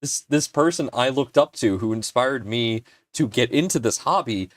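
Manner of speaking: ordinary talk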